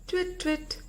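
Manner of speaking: high hooting twit call; a little owl calling from her tree. Acted. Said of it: "called out".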